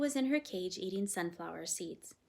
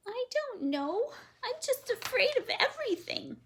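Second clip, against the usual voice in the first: put-on timid girl; 6 dB louder than usual. A narrator's reading. Girl confessing her fears to the parrot, timid. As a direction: say it like a frightened child.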